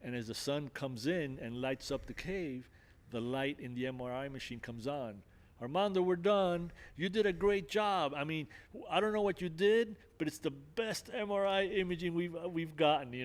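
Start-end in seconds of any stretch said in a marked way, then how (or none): 5.6-13.1 higher, louder delighted technician; excited nurse. Retold or imitated. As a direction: cheer him out of the machine, pleased. Nurse announces great scan result.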